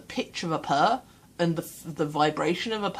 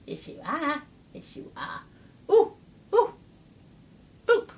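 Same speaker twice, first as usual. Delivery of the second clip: cat voice; 11 semitones higher than usual. Acted. Owner dotes on her cat in high baby talk. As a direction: coo at the cat and boop its nose. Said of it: "in my cat voice".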